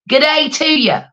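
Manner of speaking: cheery mock Aussie hello; Australian greeting. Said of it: "I know I don't sound Australian".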